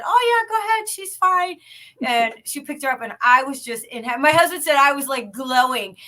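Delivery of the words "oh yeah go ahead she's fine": high, breezy voice of the lady; imitating the mother. Mimicking the Costco mom handing over her baby, high breezy voice. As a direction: lightly mimic the easygoing mother. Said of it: "the lady said".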